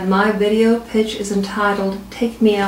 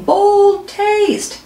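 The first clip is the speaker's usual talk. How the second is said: higher mock crowd cheer; crowd screaming slogan; 10 semitones higher than usual. Wild crowd cheers the product, mock cheering yell. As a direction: shout the slogan like fans in the stands. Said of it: "and they're screaming".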